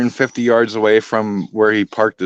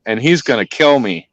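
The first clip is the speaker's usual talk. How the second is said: ordinary delivery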